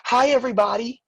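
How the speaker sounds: put-on a toddler suddenly beaming at the class; loud cheerful little-kid hello; excited child greeting. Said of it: "he goes".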